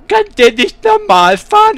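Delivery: very high, loud, drawn-out mimicry; slow, shrieked parody of a nagging passenger; mocking passenger imitation. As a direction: screech the complaint back in a mocking falsetto. Mockingly echoes complaining passenger.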